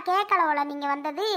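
normal talking voice

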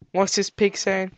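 ordinary talk, nothing marked

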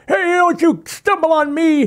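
very high, slow put-on old man's complaint; mock homeless man. Homeless guy scolding a passerby, high slow put-on voice. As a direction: whine it slowly in a thin high voice.

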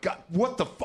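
high, exasperated cut-off curse; mock exasperation, kept in his head; exasperated outburst. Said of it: "I'm like, in my head".

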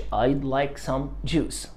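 very slow and soft; each word drawn out gently; very slow model answer. Shown as a demonstration. Teacher models answer very slowly. (إجابة بطيئة وهادئة)

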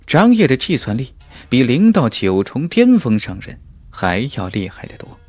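plain reading voice

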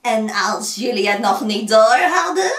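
put-on loud, croaky old witch; croaking witch. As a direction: rasp it out menacingly. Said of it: "Maar het oudje kraste".

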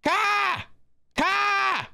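high yelling to wake a sleeper. Real, not acted. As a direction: holler his name up the stairs.

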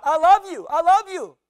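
high, loud holler across a valley; mother demonstrating a hillside shout; shouted affection. Acted. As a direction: call it out at full voice. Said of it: "and shout".

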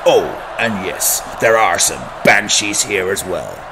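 loud, booming arena announcer hype; sports announcer. Acted. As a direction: pump up the crowd before kickoff.

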